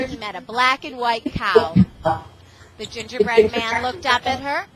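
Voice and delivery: ordinary delivery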